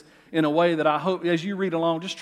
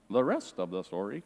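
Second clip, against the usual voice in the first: a light Paul Harvey radio imitation, lower and softer; a brief nod to the radio storyteller's sign-off, lower; 7 semitones lower, 5 dB quieter than usual. Pastor jokingly imitates how listeners hear Scripture, light radio-host voice. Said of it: "it sounds a little bit like Paul Harvey".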